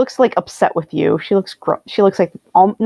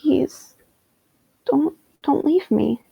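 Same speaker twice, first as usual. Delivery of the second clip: higher, softer, halting plea; soft pleading; 5 semitones higher, 5 dB quieter, slower than usual. Acted. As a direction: beg him quietly to stay. Acted plea as he leaves.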